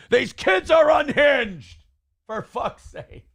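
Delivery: high, slow, hammed-up furious yell; exaggerated angry scream at kids; mock rage shout. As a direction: shout it in a fake Red Bull rage. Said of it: "As I'm shouting here in a Red Bull rage".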